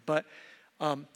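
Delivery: usual speaking voice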